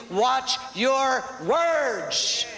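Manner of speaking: Watch your words stretched long with a rise on words; slow, drawn-out preacher's warning; drawn out slowly. Shown as a demonstration. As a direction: drag each word out to drive it home. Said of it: "preacher drives point home".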